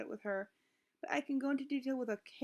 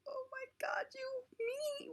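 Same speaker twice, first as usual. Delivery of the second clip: high, breathless crying whisper; real tears in a high whisper; choked-up whisper; 12 semitones higher, 4 dB quieter, slower than usual. Retold or imitated. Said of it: "I'm like".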